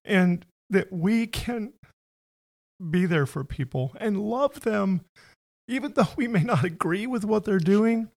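moved, pitch climbing, almost tearful; emotional. Real, not acted. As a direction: voice rising with real emotion. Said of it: "Sorry, it makes me emotional too".

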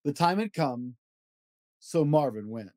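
lively read-aloud of a rhyming picture book; storybook voice, playful and rhythmic; reading to children. Acted. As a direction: bounce through the rhymes for a child at bedtime. Reads Dr. Seuss book aloud.

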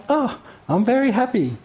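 high, hammed-up sing-song delight; mock a prophet's giddy glee; mock happy Jonah. Preacher parodying Jonah's mood swing, high and hammed-up. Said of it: "Jonah has totally lost it here".

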